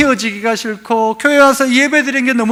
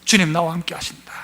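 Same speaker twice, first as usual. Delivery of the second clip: soft, lower whisper of 주님 나와 함께 하신다; whispered confession; 5 semitones lower, 6 dB quieter than usual. Shown as a demonstration. Pastor whispering the believer's inner confession. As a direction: breathe it like a private prayer. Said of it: "내 안에 증거가 있어요".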